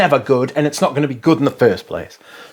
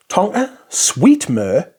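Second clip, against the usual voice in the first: normal talking voice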